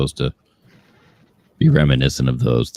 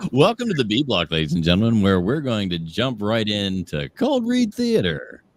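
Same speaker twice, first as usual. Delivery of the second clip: show host announcer; 5 semitones higher than usual. Acted.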